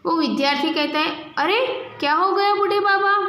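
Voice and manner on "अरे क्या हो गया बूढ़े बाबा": put-on higher, caring call to an old man; a student's polite, concerned question; respectful concern. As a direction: ask kindly after the fallen old man. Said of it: "वो respect से बात कर रहा है".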